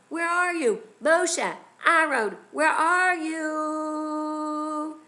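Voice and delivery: high, loud repeated calls, building; frantic calling. Acted. Storyteller as Paro running out seeking Moshe and Aaron, calling loudly. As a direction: holler for them, stretching the final call long. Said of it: "runs out of his pal-, palace calling".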